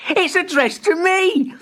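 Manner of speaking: high, slow excited voice; thrilled child. A narrator's reading. Timbertwig reads his name on the envelope, high and thrilled. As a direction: savor the surprise.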